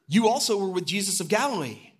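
put-on a servant girl exposing Peter by the fire; accusing servant girl. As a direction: call him out like a sharp-eyed servant girl.

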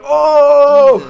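loud, high, drawn-out "ohh"; whole-class "oooh" imitated at full volume; long rising whoop of a crowd; crowd's reaction. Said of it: "Everybody's like".

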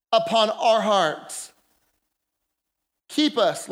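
ordinary delivery

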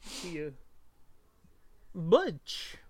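imitating the cab driver's Butch; Esmeralda's accent. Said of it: "The way Esmeralda says it".

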